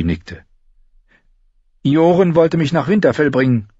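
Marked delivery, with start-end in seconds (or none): 1.8-3.7 put-on higher girl's voice; a girl explaining plainly; Arya's voice. Girl explains her journey to Gendry, higher voice.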